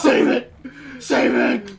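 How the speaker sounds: urgent rasping command; Bat Dad. Acted. Friend urges teammate to save, urgent Bat Dad voice. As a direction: rasp out the order twice. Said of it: "I was doing Bat Dad".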